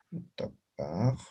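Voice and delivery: utapaj said slowly and quietly, syllable by syllable; teacher slowly models utapaj for the class; slow spelled-out demo. Shown as a demonstration. Teacher repeats the Aymara word slowly for students. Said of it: "Dice, por ejemplo".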